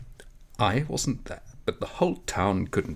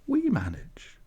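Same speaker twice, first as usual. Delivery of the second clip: put-on light girlish reply; slower than usual. A narrator's reading. Mirele's evasive reply.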